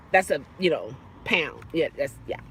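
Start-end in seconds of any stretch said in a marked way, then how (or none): none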